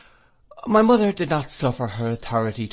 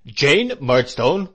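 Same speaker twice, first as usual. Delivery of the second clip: slow and louder, a booming command; thundering; 4 semitones higher, 8 dB louder, much slower than usual. A narrator's reading. Brother silences his sister, slow and thundering. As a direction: boom her name slowly. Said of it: "thundered Mister Murdstone".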